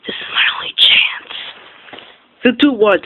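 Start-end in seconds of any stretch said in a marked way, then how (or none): 0.1-1.3 tearful whisper. Acted. Jake pleading to stay.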